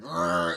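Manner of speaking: low, loud growled "rrr"; imitated growl. Ape ordering them aboard, low loud growl. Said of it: "He's like".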